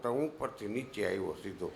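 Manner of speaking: usual speaking voice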